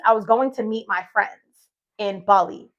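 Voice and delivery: ordinary delivery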